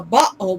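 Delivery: bottle with the t swallowed into a catch; glottal stop. Shown as a demonstration. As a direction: stop the throat mid-word in bottle. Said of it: "that's the stop".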